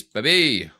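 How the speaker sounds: ordinary delivery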